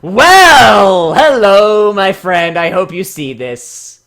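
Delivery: loud, high theatrical welcome; hammy greeting. Pretend. Streamer voicing the bio writer's grand hello.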